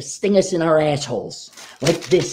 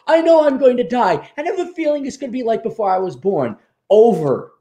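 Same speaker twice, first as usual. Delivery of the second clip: ordinary delivery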